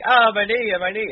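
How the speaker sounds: imitating a wrestler clutching his knee; mock pained cry. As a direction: overplay a wounded wrestler. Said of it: "he was like".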